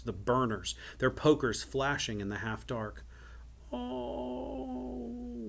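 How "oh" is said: thin, high, drawn-out wail; little wail. A narrator's reading. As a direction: wail softly like a terrified little child. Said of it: "wailed the little voice".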